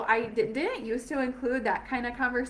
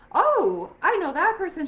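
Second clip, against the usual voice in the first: excited recognition; 7 semitones higher, 6 dB louder than usual. Retold or imitated. Imagined thought on spotting a rep.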